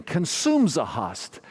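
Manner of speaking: slow, insistent repeat in imitated Croatian accent; thick Croatian accent. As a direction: raise the pitch, imitating a Croatian priest's accent. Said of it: "He said it again".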